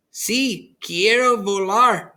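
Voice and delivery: a happy yes from grandma; grandmother's voice. A narrator's reading.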